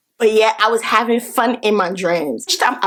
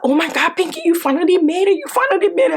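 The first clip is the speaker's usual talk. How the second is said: excited Yasmin greeting; 8 semitones higher, faster than usual. Retold or imitated. Yasmin greets her in dream.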